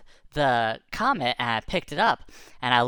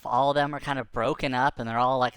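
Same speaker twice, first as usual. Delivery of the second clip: usual speaking voice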